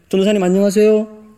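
high-pitched imitation of a child; schoolboy's greeting. Pastor's youngest son greeting the evangelist in passing, imitated child voice. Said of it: "막내 아들이 초등학생이었어요".

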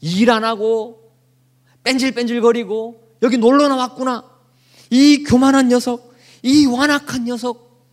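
big brother taunting the lazy little one, mock sneer; mocking scold. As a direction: drag it out with contempt. Older brother mocking David.

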